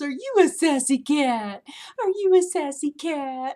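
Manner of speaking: imitating a high, loud baby-talk voice; cooing at a cat. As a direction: coo at the cat like the vet's assistant. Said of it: "and the lady goes".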